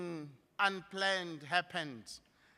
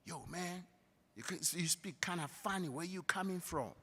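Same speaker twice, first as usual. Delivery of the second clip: put-on American accent; 11 dB quieter than usual. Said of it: "Americans will come to you and say".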